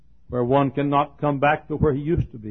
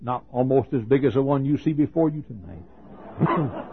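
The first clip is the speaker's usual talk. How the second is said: usual speaking voice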